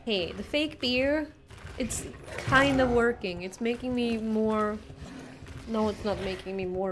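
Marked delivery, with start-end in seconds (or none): none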